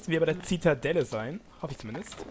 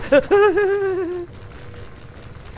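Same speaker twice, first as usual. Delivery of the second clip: loud shrill laughing; nervous high laugh; 7 dB louder than usual. Real, not acted. Startled gamer, loud nervous laugh. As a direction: laugh off the fright. Panic laugh after scare.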